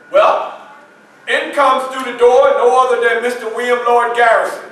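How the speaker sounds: usual speaking voice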